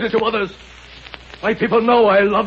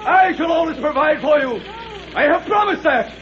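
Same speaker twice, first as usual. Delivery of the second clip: commanding shout; 7 semitones higher than usual. Acted. Maxim shouting orders at the mob from the balcony, commanding shout. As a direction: bellow orders over the noise.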